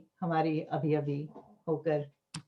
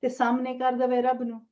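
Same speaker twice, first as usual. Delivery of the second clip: measured Punjabi verse with lilting line endings; poetry recital; 5 semitones higher than usual. Acted. Poet reading own Punjabi verse to gathering, measured recital. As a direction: declaim each line, pausing between phrases.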